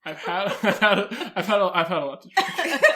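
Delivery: laughing through the words; trying to say it while cracking up. Real, not acted. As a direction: keep restarting as the giggles take over. Cracking up at own slip.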